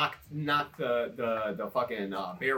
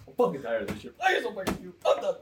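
pissed but joking; 8 semitones higher, faster than usual. Retold or imitated. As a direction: build from fed-up to a comic outburst. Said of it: "it was like a pissed, but joking".

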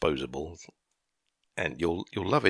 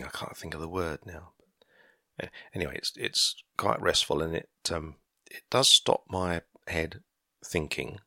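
normal talking voice

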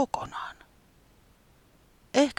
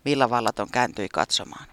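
usual speaking voice

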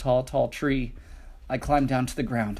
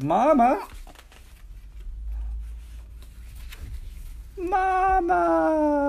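very high, loud calling; desperate yelling; much slower than usual. A narrator's reading. Lost little dragon calling for his mother, loud desperate shout. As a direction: call out with all your might. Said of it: "I yelled as loudly as I could".

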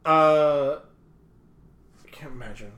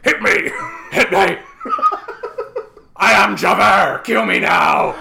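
louder laughing hammed-up dare; mock shout; 6 dB louder than usual. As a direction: bellow the dare through laughter. Hammed-up Javert defiance.

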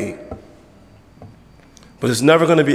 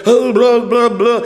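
high, louder mock gibberish; mocking babble; 7 semitones higher, 4 dB louder, slower than usual. Preacher mocks church babbling, higher and louder. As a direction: lampoon the showy babbling.

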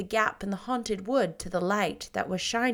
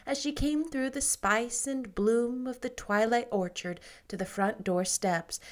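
ordinary delivery

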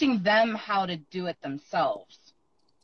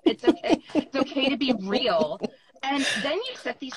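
daughter mimicking mom's pep talk, high and loud; mock her own motivational tone back at her; teasing daughter; 5 semitones higher, 3 dB louder than usual. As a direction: daughter parroting mom's coaching, a notch higher and louder. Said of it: "she had my intonation down".

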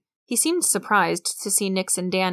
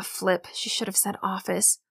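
plain reading voice

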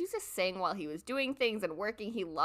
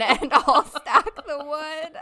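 slow and louder, dissolving into laughter; laughing; 6 semitones higher, 10 dB louder, much slower than usual. Real, not acted. Quoting herself to the trapped ex, laughing. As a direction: finish the line through a real laugh. Laughing at end of retold quote.

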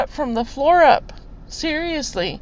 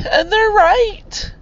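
real tearful outpouring, pitched high; high, emotional, close to crying; blubbering; 5 semitones higher than usual. Real, not acted. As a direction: push the words out through real tears. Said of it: "I'm just a blubbering".

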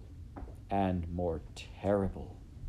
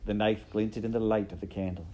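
usual speaking voice

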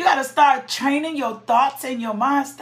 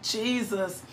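usual speaking voice

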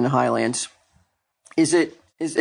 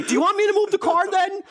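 mock angry, much higher pitched; imitating angry neighbor. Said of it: "But he said it so angrily".

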